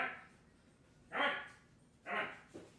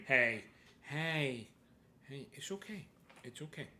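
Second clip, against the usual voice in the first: soft, gentle reassurance; quiet calming murmur; soothing a pet; 3 semitones lower, 16 dB quieter than usual. Real, not acted. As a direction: hush and reassure the animal.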